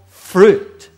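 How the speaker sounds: loud punch on fruit; shouted word. Acted. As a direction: hit fruit hard. Preacher punches key word.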